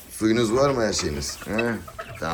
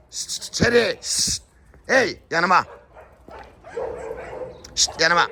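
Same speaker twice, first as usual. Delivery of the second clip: high call broken by shushing; shush, then call the animal to heel, pitched high; sharp command; 10 semitones higher, slower than usual. Real, not acted. Owner orders Çeri to come over, high commanding call.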